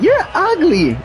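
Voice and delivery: high childish mock voice; little niece voice. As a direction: squeak it like a toddler teasing. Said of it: "She like".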